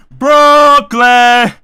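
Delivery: drawn-out, booming shout of a borough; hype shout. Real, not acted. Streamer naming boroughs he visited, hype shout. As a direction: belt it out, high and long.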